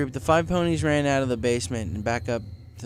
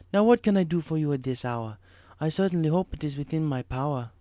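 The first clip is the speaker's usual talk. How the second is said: higher mystic zebra voice; Zecora voiced; 4 semitones higher than usual. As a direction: ask calmly in rhyme.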